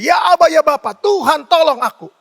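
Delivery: loud, high pleading cry; crying out to God. Acted. Preacher leading believers to call on the Lord, crying out. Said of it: "mari kita berseru pada Tuhan".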